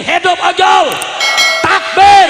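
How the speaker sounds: high, very loud slogan calls; rallying shout. Real, not acted. Preacher rouses the other side of the crowd, rallying shout. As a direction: shout each call to whip up the crowd.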